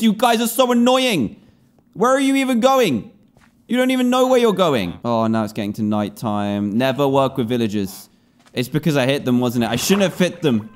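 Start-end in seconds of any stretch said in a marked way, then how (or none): 0.0-5.0 exasperated. Real, not acted. Exasperated at villagers.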